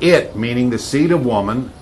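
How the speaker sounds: ordinary talk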